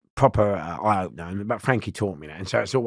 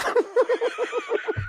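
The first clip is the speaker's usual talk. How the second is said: high giggle. Real, not acted. Laughing at the story.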